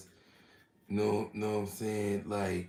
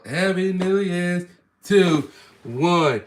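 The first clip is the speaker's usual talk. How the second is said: excited; 8 semitones higher, 8 dB louder, slower than usual. Real, not acted. Building back to cheer.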